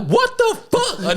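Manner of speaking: high, slow, stretched disbelief; an indignant high-pitched what the fuck, drawn out; outraged disbelief. Retold or imitated. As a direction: react like you just saw the other flight leave. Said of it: "I'm like".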